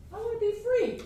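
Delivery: high, thin, longing plea; small pleading inner voice. Acted. Teacher acting out the inner self pleading to the ego, high small voice.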